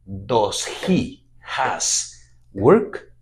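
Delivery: Does he has work said very slowly, word by word; careful, slow English for Spanish speakers; slow teaching demo. Shown as a demonstration. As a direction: model the question slowly for students. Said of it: "vamos a decir".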